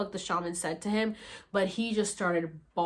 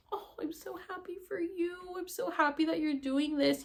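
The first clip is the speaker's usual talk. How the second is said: tender joy; 9 semitones higher, 3 dB quieter than usual. Retold or imitated. Happy for crying man.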